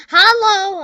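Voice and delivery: high, loud sing-song hello; chirpy hello. Real, not acted. High and loud, greeting a player in the game.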